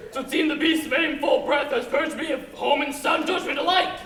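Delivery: squealing pig. Acted. Pig one squeals his excuse to pig two. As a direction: blurt it in a squealing rush. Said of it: "squealed one".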